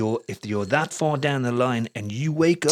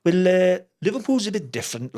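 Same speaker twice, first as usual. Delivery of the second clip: higher-pitched Liverpool accent; 6 semitones higher than usual. Shown as a demonstration. Showing Liverpool differs from Welsh, Scouse accent. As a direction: lift it into a Scouse lilt. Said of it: "it's like that".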